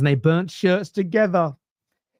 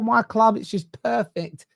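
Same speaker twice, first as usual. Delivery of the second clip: slow, higher-pitched mock adoration; mock doting fan; 7 semitones higher, slower than usual. Fans boasting about their club, slow mock gushing. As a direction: coo over it with exaggerated devotion. Mimicking besotted football fans.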